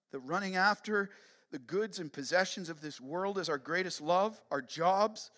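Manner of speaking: impassioned plea to God before the congregation; fervent prayer. Real, not acted. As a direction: pray with rising force, listing each failing.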